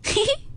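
a high sly "heh heh"; sly chuckle. A narrator's reading. Ping'er holds the hair over Jia Lian, sly chuckle. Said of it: "平儿仍拿了头发笑道".

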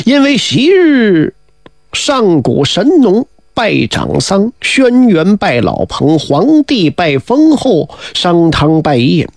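very slow, measured listing; solemn slow recital. Acted. Minister instructs the king with old precedents, slow and solemn. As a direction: intone each precedent slowly.

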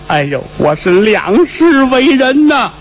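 slow, drawn-out groaning sigh; long sigh of relief. Acted. Younger brother back from the dead, slow relieved lament. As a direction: sigh it out slowly, shaken. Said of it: "老二长叹一声".

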